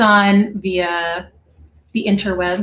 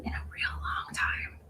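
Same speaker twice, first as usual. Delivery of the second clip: hushed quick aside; whispering; 16 dB quieter than usual. Real, not acted. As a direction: murmur it under your breath. Aside while the guests listen.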